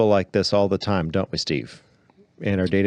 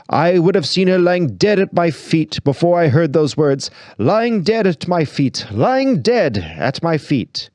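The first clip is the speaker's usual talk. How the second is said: higher and slowing, overacted grief; theatrical tragedian; 7 semitones higher, 4 dB louder than usual. Tragedian posing before the lady, slow overacted grief. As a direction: ham up the dramatic repetition. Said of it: "he said with a choking voice".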